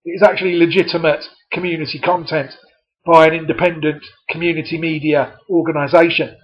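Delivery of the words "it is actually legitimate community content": usual speaking voice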